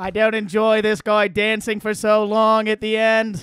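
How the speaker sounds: slow Boston attempt slipping into Australian; drawn-out, wobbly Kennedy-Australian accent; Kennedy accent turning Australian. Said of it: "you threw a little Australian".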